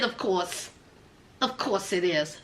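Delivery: weary sarcasm. Retold or imitated. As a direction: mutter it like a fed-up mother. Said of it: "She said".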